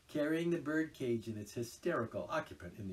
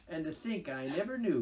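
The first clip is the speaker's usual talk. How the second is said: dreamy; 8 semitones higher, 8 dB louder than usual. A narrator's reading. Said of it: "in a dreamy monotone".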